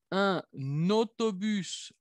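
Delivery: slow Un autobús, linked n, S sounded; liaison and final S. Shown as a demonstration. Teacher models slow liaison and final S. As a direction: drag out Un autobús, join the n, say the S. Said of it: "hago la liaison, pronuncio la S".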